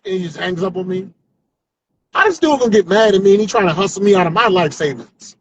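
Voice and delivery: ordinary talk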